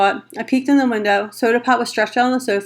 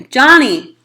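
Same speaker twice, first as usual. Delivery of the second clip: louder call across the lot; 3 semitones higher, 6 dB louder than usual. A narrator's reading. Ponyboy calling out to Johnny in the lot, loud. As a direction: call to him in the dark. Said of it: "I called".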